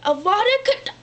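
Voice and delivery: shouted spell; killing curse. Retold or imitated. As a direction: fire off the curse. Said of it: "he's like".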